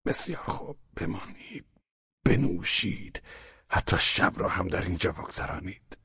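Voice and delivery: breathy, fading whisper, forced out; a sick man yielding in a fading whisper; halting, with difficulty. Acted. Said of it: "با لکنت زبان و به دشواری گفت".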